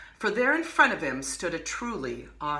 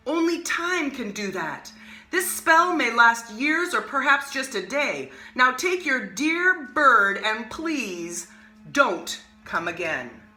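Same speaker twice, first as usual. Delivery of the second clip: higher, slow, dismissive magician; haughty magician; 6 semitones higher, 4 dB louder than usual. Acted. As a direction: wave the boy off with mock courtesy.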